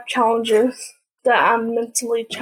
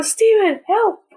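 higher, playful plea; mock cry for help; 9 semitones higher than usual. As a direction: call out as if being caught in a game. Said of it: "I go".

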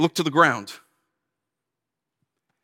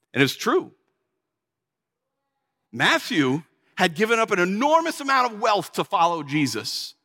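normal talking voice

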